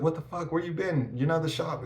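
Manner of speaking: high, pressing questions; surprised grilling of an old mentor; retold disbelief. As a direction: demand where he's been, high-pitched. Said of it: "I was like".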